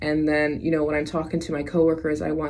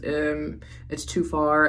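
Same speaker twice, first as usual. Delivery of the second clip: ordinary talk, nothing marked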